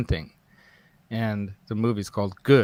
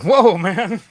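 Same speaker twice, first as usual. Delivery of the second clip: higher, louder wired-up whoa; mock jolted whoa; 9 semitones higher, 8 dB louder than usual. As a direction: react like the coffee just hit. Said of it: "he was like".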